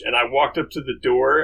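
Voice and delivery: ordinary talk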